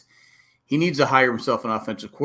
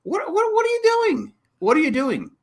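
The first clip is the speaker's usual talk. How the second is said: high, exasperated squeal of disbelief; a fan yelping at the TV; frustrated outcry. Retold or imitated. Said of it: "I just was so frustrated watching that game going".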